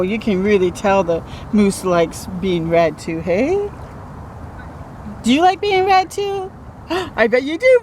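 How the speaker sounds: ordinary delivery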